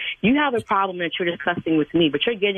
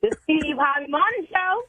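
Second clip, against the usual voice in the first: playful on request; 8 semitones higher than usual. Pretend. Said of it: "in your tone?".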